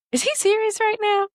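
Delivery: high-pitched disbelief, acted out; incredulous question. Girl at karaoke questions wife, retold incredulity. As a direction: ask it high, aghast on a friend's behalf. Girl reacts to terrible singing.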